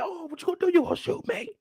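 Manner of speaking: high taunting voice sinking to a whisper; woman daring the dealers, in a high mock voice; defiant woman. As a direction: dare them to shoot, high and quiet. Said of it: "She said".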